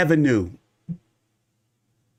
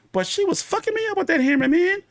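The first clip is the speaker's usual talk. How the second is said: high, emphatic, worked up; excited high pitch. Real, not acted. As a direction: stress the beating, worked up. Excited retelling.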